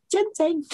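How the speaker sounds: ginseng pitched very high, falling, in imitated Chinese manner; Chinese-style pronunciation. Shown as a demonstration. Speaker showing how we say it, ginseng high and falling as the Chinese did. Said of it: "so that's how we say it".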